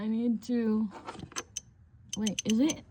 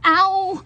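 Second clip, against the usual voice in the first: sudden pained yelp; sharp ouch; 7 semitones higher, 6 dB louder than usual. Real, not acted. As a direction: cry out from a hit funny bone. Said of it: "I hit my funny bone".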